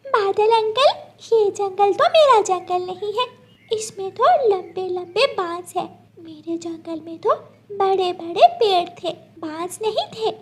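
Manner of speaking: put-on a small child asking how to get home; little bird child. Gudiya asking the cloud for help, high worried child voice.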